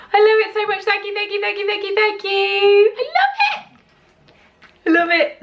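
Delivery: very high, crying-out thanks; ecstatic shriek. Real, not acted. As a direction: shriek your gratitude. Screaming thanks at gift.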